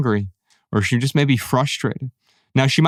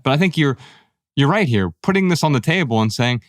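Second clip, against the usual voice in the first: usual speaking voice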